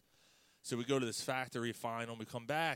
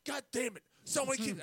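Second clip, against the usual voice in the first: much higher and louder mock outburst; mock rage; 13 semitones higher, 4 dB louder than usual. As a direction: blow up in fake fury.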